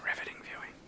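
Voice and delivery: dry whispered aside. Real, not acted. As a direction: whisper it to yourself, deadpan. Repeats joke under breath.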